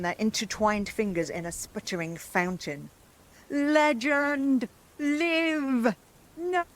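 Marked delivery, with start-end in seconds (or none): 3.5-6.6 high, loud incantation cries; high chanted witch cries; witch invocation. Acted. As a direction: call the legend alive.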